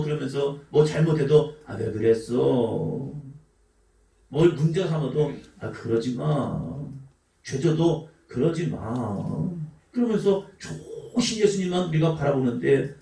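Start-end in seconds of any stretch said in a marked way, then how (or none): 1.7-3.1 gentle chiding. Pretend. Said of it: "우리 예수님은 좋으신 예수님". 5.6-6.6 gentle chiding. Pretend. 8.3-9.5 slow, soft, lower indulgent voice; indulgent Jesus. Pretend. Voicing a gentle Jesus to the congregation, slow and soft.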